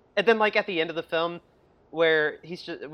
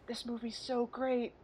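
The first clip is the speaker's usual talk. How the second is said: mock-gushing; 6 semitones higher, 11 dB quieter, slower than usual. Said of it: "and just be like".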